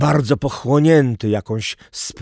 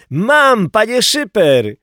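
high, loud yell up to the boss; excited high-pitched holler; boy's shout; 11 semitones higher, 6 dB louder, slower than usual. A narrator's reading. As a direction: shout it out high like a young lad. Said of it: "krzyknął chłopak".

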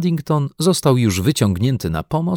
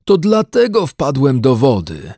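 wailing bear; 5 semitones higher, 5 dB louder than usual. A narrator's reading. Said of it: "zawodził Paddington".

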